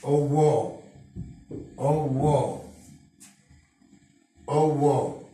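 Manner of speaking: أوو as a drawn oo sound; vowel demo. Shown as a demonstration. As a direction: model the oo vowel three times. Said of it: "واو العطف،". (نطق صوت أوو بوضوح)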